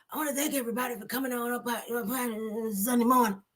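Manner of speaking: high, soft, hurried imitation of a frail preacher; Eddie Long. Host mimics a preacher thanking the congregation, high soft imitation. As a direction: rush it out in a small put-on voice. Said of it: "That's how he was talking".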